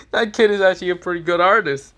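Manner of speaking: high, quick chuckling praise; amused Shikamaru. Retold or imitated. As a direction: laugh through the compliment. Laughing Shikamaru voiced.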